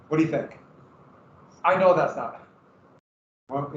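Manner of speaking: usual speaking voice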